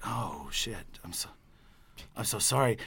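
small, low, soft voice; quietly mortified, played back; sheepish apology. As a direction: act out a meek, halting apology. Retells his sheepish apology.

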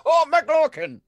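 high, silly leprechaun cry; McLokin impression. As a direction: call it out like a cartoon leprechaun. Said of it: "were you guys impersonating me?".

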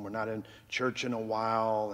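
usual speaking voice

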